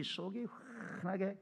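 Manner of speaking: 훤하게 opened with a long airy breath; drawn-out emphasis. Real, not acted. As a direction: linger on the first sound of 훤하게. Repeats drawn-out emphasis on see-through. (훤하게의 첫소리를 숨 섞어 길게 끌기)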